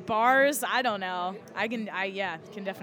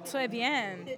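put-on French accent; 13 semitones higher, 13 dB louder, much slower than usual. Answering in mock French.

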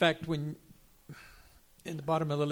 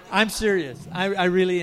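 laughing loudly; 4 semitones higher, 11 dB louder than usual. Real, not acted. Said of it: "I'm so serious inside".

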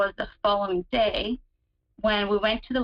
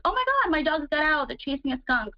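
higher, mildly alarmed cry; retold panic; 6 semitones higher than usual. Retelling her dogs chasing a skunk at night, mild mock panic. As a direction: replay the panic as the dogs bolt, lightly. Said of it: "I panicked".

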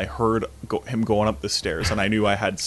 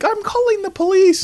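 very high, louder fussy voice; alarmed bystander; 8 dB louder than usual. Pretend. Onlooker threatening to call police, high fussy voice. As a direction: play a scandalized onlooker.

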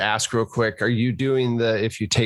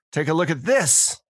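raised, emphatic call; urgent reveal; 6 semitones higher than usual. Acted. Player showing the culprit's tattoo to the marshal, urgent reveal. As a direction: present the proof with a higher voice.

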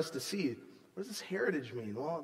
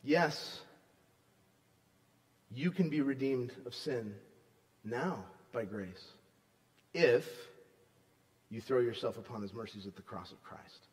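soft appeal; 6 semitones lower, 4 dB quieter than usual. Acted. Pastor inviting listeners to accept grace, soft and tender. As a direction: invite them gently to grace.

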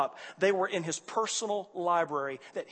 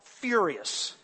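ordinary talk

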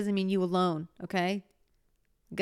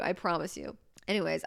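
ordinary talk

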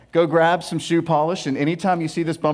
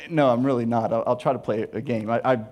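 normal talking voice

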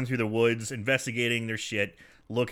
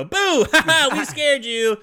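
put-on much higher, loud cackling kids; pranking kid voice; 12 semitones higher, 7 dB louder than usual. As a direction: shout it like teasing boys. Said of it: "jump out and go".

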